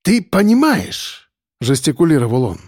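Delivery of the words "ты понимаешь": slow, high, dramatic opener; drawn-out, high appeal; theatrical. A narrator's reading. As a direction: begin theatrically, drawing the words out. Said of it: "Акбар сделал театральный вдох и начал".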